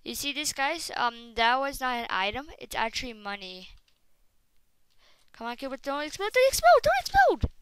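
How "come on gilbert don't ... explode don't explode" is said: pleading that climbs into a much higher, louder yell; begging the creeper, rising to a frantic shout; from a coaxing plea up to a high panicked scream. Real, not acted. Begging creeper not to explode.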